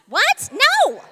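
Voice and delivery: high, loud, laughing cry of No; exasperated shout. Acted. Correcting the brain that misheard, in a high laughing shout. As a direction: yelp in disbelief with a laugh.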